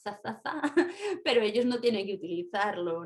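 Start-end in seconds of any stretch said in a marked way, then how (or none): 0.0-0.6 "za, za, za" with a lisped th, like "tha"; Castilian "za" as a soft "tha", a little quieter; Spanish Z sound. Shown as a demonstration. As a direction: sound out "za" three times with the tongue between the teeth. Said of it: "yo utilizo el sonido Z".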